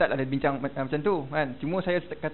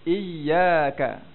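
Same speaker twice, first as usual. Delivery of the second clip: "Iyyaka" with a firm, held "yy"; stressed doubled ya; 4 dB louder than usual. Shown as a demonstration. Teacher explains the syiddah, pressed "yy" in "Iyyaka". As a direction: bounce hard on the "yy" of "Iyyaka". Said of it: "dia tekan huruf tersebut".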